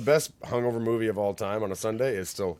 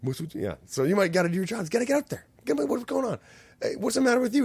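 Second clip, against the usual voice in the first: much higher mock French pimp accent; 13 semitones higher, 3 dB louder than usual. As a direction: squeal it out in a put-on French accent. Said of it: "it's all in a French accent".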